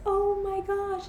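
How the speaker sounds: high, drawn-out amazed voice; imitated awe. Grandma seeing the engagement ring, imitated awe. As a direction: draw it out high, as awestruck grandma.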